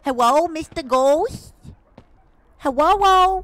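high, loud, drawn-out cutesy call; ooh-voicing. Player calls out to the ghost, full-on ooh voice. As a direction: coo loudly to the ghost with rounded vowels. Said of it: "[name]'s like this full-on ooh voicing".

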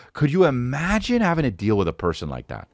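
usual speaking voice

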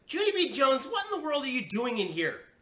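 high, loud scolding shout; mother's shout. A narrator's reading. Mother catches Junie B. in her room, shouting. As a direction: shout it in shocked outrage. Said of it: "she shouted".

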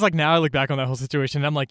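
ordinary delivery

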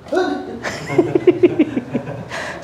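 long airless chuckle; breathy laugh. Real, not acted. Healer laughing at his own joke, under the breath.

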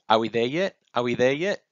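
put-on insistent child's nagging; impatient little one. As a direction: pester like a child on a long trip. Impatient child on long walk.

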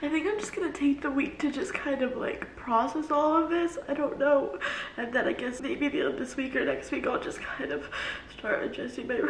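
trying to talk on through real tears; crying. Real, not acted. Vlogger planning the week after a layoff, crying. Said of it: "I was gonna cry".

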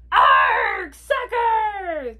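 high, loud echoing pirate yell; crew yell. A narrator's reading. Crew echoes the captain at soccer, high yell. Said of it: "the crew yelled".

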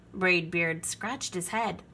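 usual speaking voice

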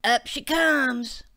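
called out loud and slow; hollering. Acted. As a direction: holler it across the yard. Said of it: "Shane hollered".

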